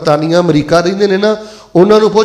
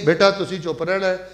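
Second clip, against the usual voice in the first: quiet aside; 9 dB quieter than usual. Real, not acted. Preacher hushing a child in mid-sermon, in a quiet aside. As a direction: drop the volume for a brief instruction.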